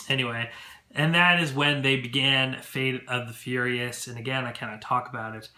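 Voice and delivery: ordinary talk, nothing marked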